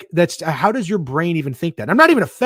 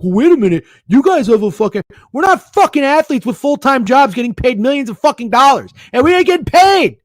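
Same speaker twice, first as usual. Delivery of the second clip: loud, much higher mocking rant; mocking outraged rant; 11 semitones higher, 9 dB louder than usual. Host mocking a fan's take, shrill outraged mock shout. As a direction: scream it like a whiny fool demanding pay. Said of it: "to be like".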